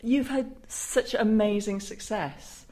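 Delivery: ordinary delivery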